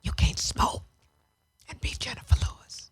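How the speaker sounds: hushed, breathy whisper to herself; quiet self-reproach. Real, not acted. Scolding herself in a whisper about smoking. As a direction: whisper it like a hard truth to yourself.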